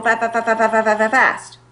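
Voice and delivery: rapid stuttering fa-fa-fa to show speed; stuttered fast demo. Shown as a demonstration. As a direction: rattle off fa- fa- fa- like a rushing speaker. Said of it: "they go so fast".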